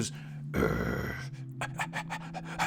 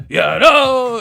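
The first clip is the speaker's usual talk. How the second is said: [name] impression; 10 semitones higher, 8 dB louder than usual. Imitating [name].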